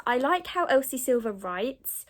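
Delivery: ordinary talk, nothing marked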